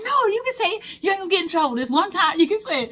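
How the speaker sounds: high, coaxing mock-parent voice, breaking into a laugh. Parent filming urges the kid to vent, high and coaxing. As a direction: play the parent sweetly urging the kid to speak. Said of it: "the parent be like".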